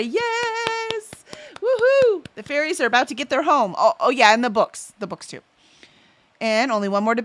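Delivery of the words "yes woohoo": high delighted "Yes!" and "Woohoo!"; excited cheer. Real, not acted. Streamer celebrates a chat update, cheering high. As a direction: cheer for the finished bookcases. Cheering news of new bookcases.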